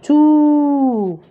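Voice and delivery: "tout" high and loud with a long oo; pronunciation model. Shown as a demonstration. Teacher showing how "tout" sounds, high held vowel. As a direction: hold the oo in "tout", final T silent. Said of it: "ça se prononce".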